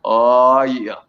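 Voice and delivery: mock late-night seduction, deep and unhurried. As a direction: drop it low and take your time.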